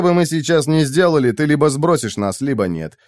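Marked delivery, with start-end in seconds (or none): none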